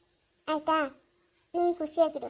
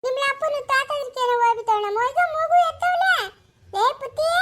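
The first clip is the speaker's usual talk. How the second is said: excited; 8 semitones higher, 4 dB louder than usual. Acted. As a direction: stretch it out in delight that the baby woke. Grandmother excited, baby is awake.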